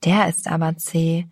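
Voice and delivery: usual speaking voice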